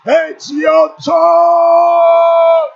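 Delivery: loud, high, building proclamation with a long held last word; full-force preaching. Real, not acted. Preacher declaring blessings over the congregation, full-force shout. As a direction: shout each blessing out slowly, punching every phrase.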